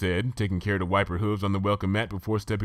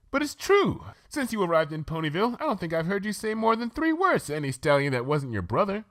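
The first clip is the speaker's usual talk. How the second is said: much higher, posh-sounding unicorn lady, chatty; Rarity, refined lady; 11 semitones higher than usual. Acted. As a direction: play the prim fashion pony gushing about tea. Rarity voiced by reader.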